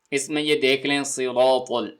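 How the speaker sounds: usual speaking voice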